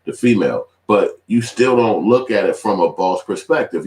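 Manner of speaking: usual speaking voice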